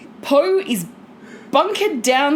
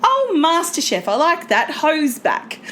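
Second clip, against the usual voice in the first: a touch higher mum, pleased; mock mum voice; 6 semitones higher than usual. Mum cheering the show's return, lightly higher. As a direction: mum delighted about the show. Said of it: "I'm like".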